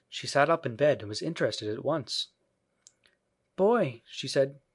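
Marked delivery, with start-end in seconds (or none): 3.6-4.0 put-on high child's address; little girl. A narrator's reading. Jane addresses the crying stranger, high little-girl voice. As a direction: call him in a small girl's voice.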